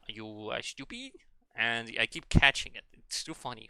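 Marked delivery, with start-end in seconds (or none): 0.1-1.1 hammed-up French accent, squeaky final word; imitated French accent. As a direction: tease in a put-on French accent. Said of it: "They keep saying things funnily".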